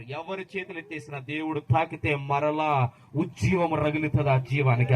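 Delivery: ordinary talk, nothing marked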